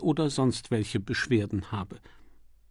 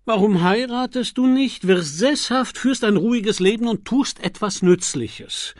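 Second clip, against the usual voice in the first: high, loud, mildly indignant demand; unappeased; 10 semitones higher, 6 dB louder than usual. A narrator's reading. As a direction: press the question, still annoyed. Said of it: "fragte der Herzog unbesänftigt". (ungehalten, laut und hoch)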